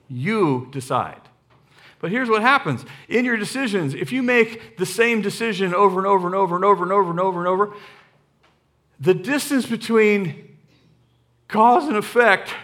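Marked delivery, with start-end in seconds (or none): 3.9-7.7 fast rattled repetition of over and over; rapid run-on. Acted. Preacher speeding through over and over. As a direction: rattle the overs off quickly without a breath.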